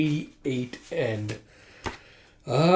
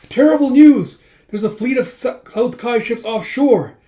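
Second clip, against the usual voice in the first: much higher and louder, frantic; alarmed shout; 10 semitones higher, 10 dB louder than usual. Acted. Messenger bursting in on the merchants with alarming news, shouting. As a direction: burst in yelling the bad news.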